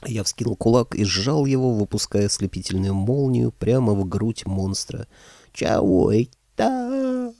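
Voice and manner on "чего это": very high, very slow monster voice; surprised monster. Acted. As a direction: drawl the puzzled monster line. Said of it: "удивился мужик".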